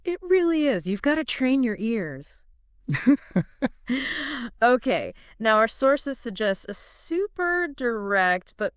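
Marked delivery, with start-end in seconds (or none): 2.9-4.6 short, high, loud laugh. Real, not acted. Co-host amused by the recurring listening theme, laughing.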